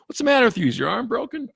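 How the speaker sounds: high, loud New Jersey scolding; wife snapping in a New Jersey accent. Retold or imitated. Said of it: "she has this New Jersey accent".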